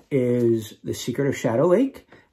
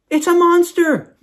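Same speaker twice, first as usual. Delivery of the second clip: high shrieking cry, much louder; 8 dB louder than usual. A narrator's reading. Fairy shrieking at a noise outside, high and loud. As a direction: shriek it in terror. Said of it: "Wisp shrieked".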